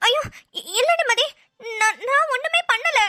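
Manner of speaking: high-pitched, flustered protest; panicked denial. Acted. Accused of harming the grandfather, panicked denial. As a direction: deny it, tripping over the first word.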